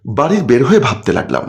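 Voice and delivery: ordinary delivery, nothing marked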